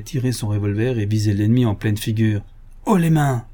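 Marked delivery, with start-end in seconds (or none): 2.8-3.5 hands up, shouted higher; loud command. A narrator's reading. Ganimard aims his revolver at Lupin, loud order. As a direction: bark it at gunpoint. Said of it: "s'écria-t-il".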